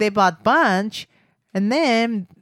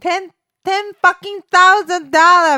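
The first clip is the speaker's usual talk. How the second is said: excited; 9 semitones higher, 7 dB louder than usual. Real, not acted. Huge prize seen on ticket.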